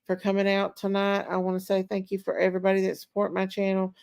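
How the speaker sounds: usual speaking voice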